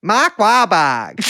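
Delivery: put-on loud, high, drawn-out Carolina accent. Showing how the moviegoer said it, loud slow Carolina accent. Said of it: "It was like that".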